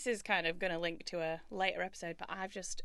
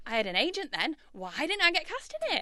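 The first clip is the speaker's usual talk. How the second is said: higher-pitched, put-out protest; mock indignation; 7 semitones higher, 5 dB louder than usual. Local actor griping about missed casting, mock indignant. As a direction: complain as if personally snubbed by casting.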